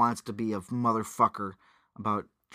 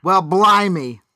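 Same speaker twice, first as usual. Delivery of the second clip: loud mock Irish lines; 7 semitones higher, 11 dB louder than usual. Friend riffing on mobster lines, loud mock Irish. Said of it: "say Irish things but sound just like a person".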